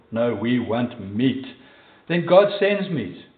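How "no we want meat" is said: slow, lower mock grumble; grumbling. Israelites refusing what God provides, slow mock grumbling. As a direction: stretch it out like complaining Israelites. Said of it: "They grumble".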